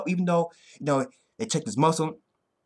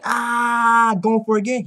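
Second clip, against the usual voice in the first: higher, louder retold yell; excited retold cry; 8 semitones higher, 6 dB louder, slower than usual. Fan retelling the fighter's next attack, excited mock yell. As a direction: call it out like the fighter lunging in.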